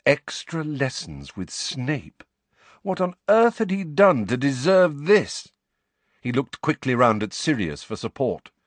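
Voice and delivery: plain reading voice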